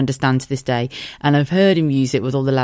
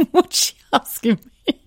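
high, giggly puzzled; high laughing; 12 semitones higher than usual. Retold or imitated. Mike asking his sister-in-law in confusion, high giggling. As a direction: ask it high through giggles. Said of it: "Mike looked at me and went".

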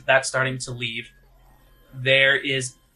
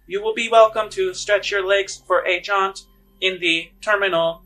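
higher, louder formal announcer voice over music; PA announcement; 5 semitones higher, 7 dB louder than usual. Acted. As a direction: read it like a station loudspeaker. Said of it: "the P A system kinda comes on".

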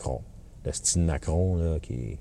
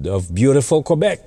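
mock radio host; 8 semitones higher, 5 dB louder than usual. Mocking the radio host's English.

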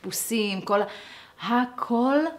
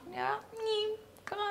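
tiny voice; 14 semitones higher, 13 dB quieter than usual. Acted. Shrinking voice for becoming small.

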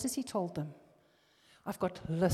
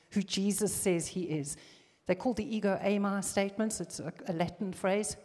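ordinary talk

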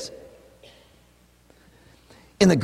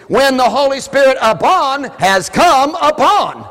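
high, loud slow reading stressing upon; emphatic literal reading; 11 semitones higher, 7 dB louder, slower than usual. Shown as a demonstration. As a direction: read the literal translation, hammering upon. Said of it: "It would read".

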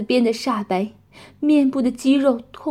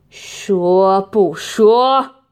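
very slow, loud threatening demand; menacing question; 2 semitones lower, 8 dB louder, much slower than usual. Acted. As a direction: drag out the threat word by word. Torturer questions her again.

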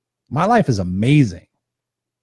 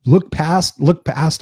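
ordinary talk

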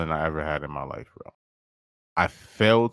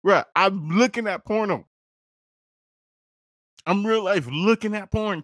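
amazed excitement; 12 semitones higher than usual. Real, not acted. Incredulous about his own reaction.

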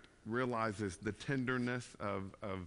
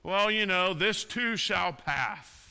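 mocking wishful platitude; 8 semitones higher, 10 dB louder, slower than usual. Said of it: "make wishful statements".

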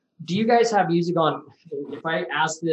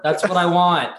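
ordinary delivery

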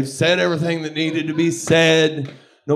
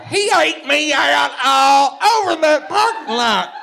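high shouted repeat of the quote; mock shout; 12 semitones higher, 4 dB louder than usual. Mimicking the woman loudly to the crowd, shouted. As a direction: yell the line back in disbelief.

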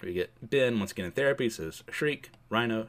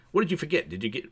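ordinary talk

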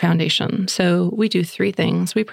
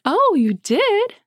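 much higher, slow, sing-song oh; delighted surprise; 14 semitones higher, much slower than usual. Retold or imitated. Mother hearing her son got her a gift, delighted surprise. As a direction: gush like a touched mom.

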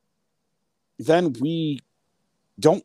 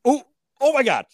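shocked disbelief; 13 semitones higher than usual. Retold or imitated. Fan reliving the buzzer-beater goal to his cohost, high excited disbelief. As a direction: gasp it out like you can't believe your eyes. Retelling the last-second goal.

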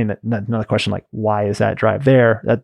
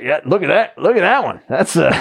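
imitating a gruff cowboy; 5 semitones higher than usual. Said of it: "I always talk like some kind of".

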